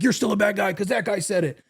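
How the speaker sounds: high mock voice of the online crowd; high sarcastic impression of the haters. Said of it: "They would have been like".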